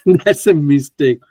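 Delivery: laughing slowly through the words; real rueful laugh at his own blunder; laughing at himself. Real, not acted. Teacher laughing to students at his own trade mistake, rueful laugh.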